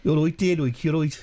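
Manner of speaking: Birmingham accent demo; Brummie accent. Shown as a demonstration. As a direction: show how Brummies say it. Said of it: "your best D J voice".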